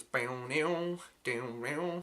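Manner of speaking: mouthed guitar twangs; imitated guitar riff. Reviewer showing the guitar line he loves, mouthed riff. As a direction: voice the riff in stretched, bending syllables. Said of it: "the guitar here that goes".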